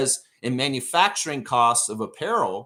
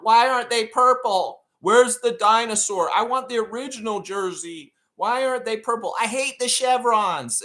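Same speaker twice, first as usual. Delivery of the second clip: mocking whiny critic; 7 semitones higher than usual. Host mocking Reddit critics, high whiny parody voice. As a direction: sneer it like a fussy complainer. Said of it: "going on about".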